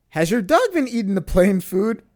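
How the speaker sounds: acted-out indignant questioning; retold confrontation. Acts out confronting her.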